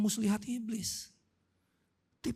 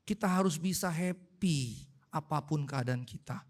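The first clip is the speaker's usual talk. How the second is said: ordinary talk, nothing marked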